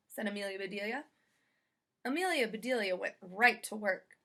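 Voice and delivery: plain reading voice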